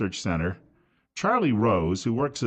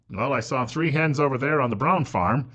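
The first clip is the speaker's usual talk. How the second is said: ordinary delivery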